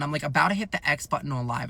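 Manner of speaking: usual speaking voice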